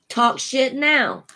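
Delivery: slow, drawled Cajun grandpa line; grandpa's Cajun accent. Retold or imitated. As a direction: channel an old Cajun grandpa, slowly. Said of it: "my accent changes too when I channel what my grandpa says".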